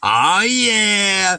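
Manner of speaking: normal talking voice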